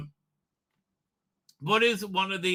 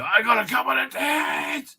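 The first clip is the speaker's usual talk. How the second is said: higher, shouted mock line; 8 semitones higher than usual. Mocking a suitor, a high mock shout. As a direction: belt it out as a joke.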